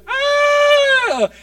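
sudden high shrieking scream as Legion; wild scream. Retold or imitated. Preacher acting out Legion's scream at the pastor's coffee, shrieking. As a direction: let out a madman's shriek.

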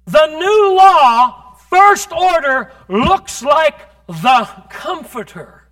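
loud, high, slow fervent preaching; full-force preaching. Acted. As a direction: preach it with everything.

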